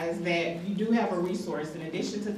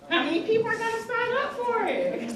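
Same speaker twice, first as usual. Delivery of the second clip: much higher, sing-song re-ask of the question; coaxing repeat. Real, not acted. Host urging the room to raise hands, in a high coaxing call. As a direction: pitch it up high to coax the crowd.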